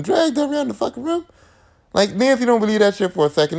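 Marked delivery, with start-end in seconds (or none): none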